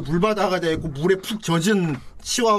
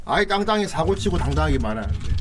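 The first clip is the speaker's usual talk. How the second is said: usual speaking voice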